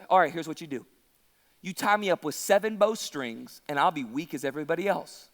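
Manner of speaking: low, subdued, matter-of-fact voice; Samson giving in. Acted. Preacher plays Samson telling Delilah a secret, low and casual.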